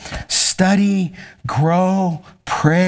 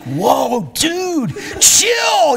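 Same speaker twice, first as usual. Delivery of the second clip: startled protest; 10 semitones higher, much slower than usual. Retold or imitated. Father reacting to his intense son, high startled protest.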